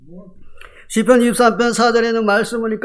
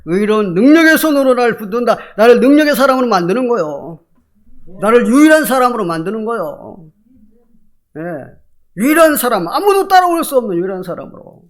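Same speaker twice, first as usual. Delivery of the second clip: louder, rising proclamation; preaching at full force; 4 semitones higher, 5 dB louder than usual. Real, not acted. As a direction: drive the point home to the church. Preacher builds to climax.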